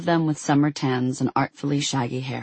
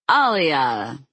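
booming; 3 dB louder than usual. A narrator's reading. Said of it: "said a booming voice".

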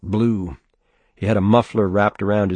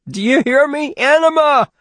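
much higher, loud defiant scream; 6 dB louder than usual. A narrator's reading. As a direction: scream it in his face. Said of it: "Bruce screamed".

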